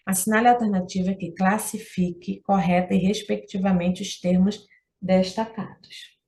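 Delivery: ordinary talk, nothing marked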